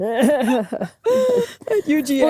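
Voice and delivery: slow, choked-up words through crying; laugh-cry, overcome with emotion; tearful. Real, not acted. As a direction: voice breaking into real tears. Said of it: "I'm crying now".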